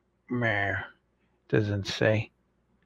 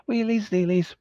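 sing-song segment title; 8 semitones higher than usual. Pretend. Segment jingle name.

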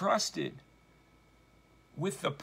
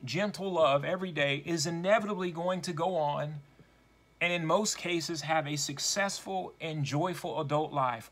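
ordinary talk, nothing marked

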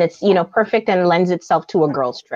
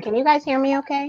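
normal talking voice

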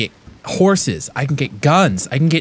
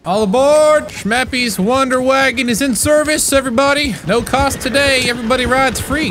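mock conductor; 9 semitones higher than usual. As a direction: sell the free ride like a showman. Announcing his wagon service.

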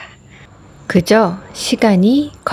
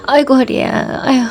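higher, half-hearted mock moan over a sore back; mock groaning about back pain; 5 semitones higher than usual. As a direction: play the victim with a light fake groan. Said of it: "피해자 코스프레 하면서". (허리 아픈 척 엄살 부리는 피해자 코스프레 말투)

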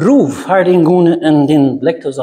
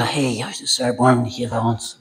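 much softer, lowered timid call; mock half-hearted plea; 4 semitones lower, 8 dB quieter than usual. Preacher shows how not to call Jesus, mock feeble plea. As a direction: murmur it as a feeble imitation of crying out. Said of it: "No, das sagt, der schrie".

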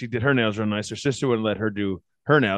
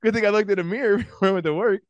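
laughing self-quote; 8 semitones higher than usual. Retold or imitated. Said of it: "I'm like".

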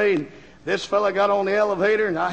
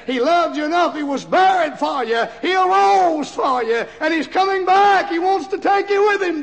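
rising preaching; 9 semitones higher than usual. Retold or imitated. Daughter urging her father to faith, rising preached climax. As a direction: preach it upward to a peak. Preaching crescendo within letter.

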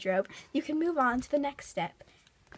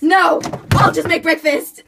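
shouting; 3 semitones higher, 14 dB louder than usual. Acted. Blooper retake, laughing.

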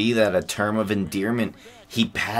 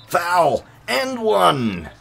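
much higher, louder shout of triumph; excited call; 10 semitones higher, 5 dB louder, much slower than usual. Real, not acted. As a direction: yell it like you just scored through contact. Calling his own and-one play.

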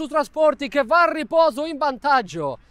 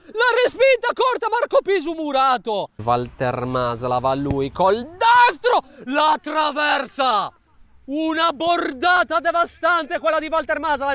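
shouted goal commentary; 4 semitones higher than usual. Real, not acted. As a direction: shout the crossbar chance, then slow down. Shot hits crossbar.

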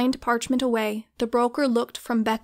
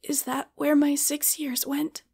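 higher, a voice on the edge of cracking; a touch of a cracking voice; 4 semitones higher than usual. A narrator's reading. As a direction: fight to keep the voice from breaking. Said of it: "Unable to keep her voice from cracking".

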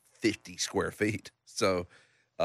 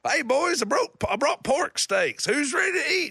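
much higher and louder, hearty dad call; imitating dad; 4 dB louder than usual. Dad barging into the houseboat with pork steaks, high hearty mock call. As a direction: boom it out like a jolly dad at the door.

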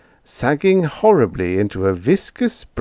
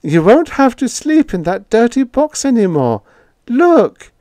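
much higher, louder, building to a cry; much higher showy delight; forced delight; 8 dB louder than usual. A narrator's reading. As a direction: sell the surprise with beaming cheer. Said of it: "with what she hoped was infectious delight".

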